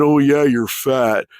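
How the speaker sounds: slow, sneering mock of his insult; slow mocking imitation of a chat insult; mocking quote. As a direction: drag it out like a whiny troll.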